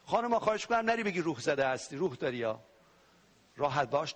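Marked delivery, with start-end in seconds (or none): none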